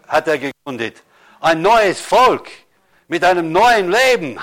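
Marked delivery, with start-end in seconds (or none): 1.4-4.4 loud, slow preaching cry, dragging each word; proclaiming. Acted. Pastor to the congregation, slow, loud proclaiming. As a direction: call it out to the church like a promise.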